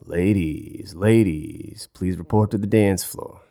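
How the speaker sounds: mock smooth emcee call; a hint of a club announcer drawing out ladies; half-hearted emcee call; lightly voiced strip club emcee. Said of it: "I should go work in a strip club".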